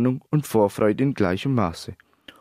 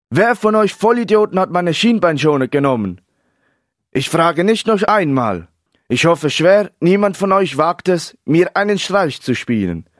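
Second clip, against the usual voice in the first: loud, high-pitched outburst; raised, furious demand; angry shouting; 7 semitones higher, 6 dB louder than usual. A narrator's reading. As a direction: shout it at the whole room in fury. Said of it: "schrie er ohrenbetäubend".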